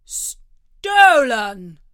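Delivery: loud indignant cry; exclaiming. Acted. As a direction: accuse him outright. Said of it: "the king exclaimed".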